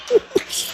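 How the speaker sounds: soft, high little laugh; quick breathy chuckle; light laugh. Real, not acted. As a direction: giggle quietly, without voice. Laughing after doctor's question.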